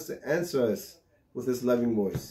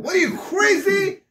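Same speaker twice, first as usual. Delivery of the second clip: much higher, louder alarmed yell; screaming; 5 dB louder than usual. Retold or imitated. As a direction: yell at the kid who ran into the street. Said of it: "starts screaming at him".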